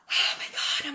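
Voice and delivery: emotional whisper. Retold or imitated. Empath overwhelmed, about to cry.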